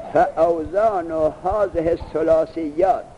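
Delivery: slow, measured recitation of the Arabic text; reading the text aloud. Shown as a demonstration. Teacher reads the Arabic source slowly to his student. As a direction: read the line slowly, like reciting a lesson text.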